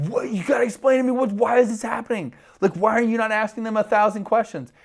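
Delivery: high, loud frantic demand; panicked drunk shouting. Retold or imitated. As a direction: yell it in disbelief at the guy. Retold frantic demand to translator.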